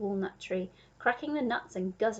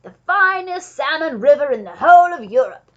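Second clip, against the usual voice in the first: loud, higher boast; proud cry; 5 semitones higher, 16 dB louder than usual. A narrator's reading. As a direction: proclaim it with booming pride. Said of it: "cried the Duke".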